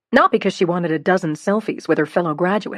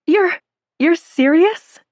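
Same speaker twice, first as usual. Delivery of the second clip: high, slow, loud disbelief; can't believe what she hears; stunned disbelief; 10 semitones higher, 5 dB louder, slower than usual. A narrator's reading. Stunned by job offer.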